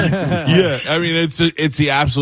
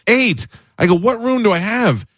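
retold astonished question; incredulous; 12 semitones higher than usual. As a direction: pitch jumps high in disbelief. Retold shock at room size.

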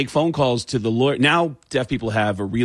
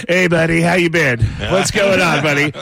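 louder, imitating Tom Arnold's friendly greeting; Tom Arnold impression; 4 semitones higher, 5 dB louder than usual. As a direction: greet your buddy eagerly. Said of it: "And Tom Arnold goes".

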